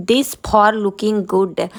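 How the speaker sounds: very slow, word-by-word broken English; halting schoolchild English, each word drawn out; children's broken English, imitated. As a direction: mimic a child labouring through English. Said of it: "बच्चों की टूटी फूटी अंग्रेजी".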